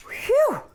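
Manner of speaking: high, swooping "whew"; exaggerated whistle-like sigh of relief; relieved whew. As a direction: pretend phew after hard work. Relief after pretend hard pull.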